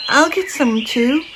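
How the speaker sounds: a tiny eager bear cub pleading; little bear voice. A narrator's reading. Little Bear's voice in story.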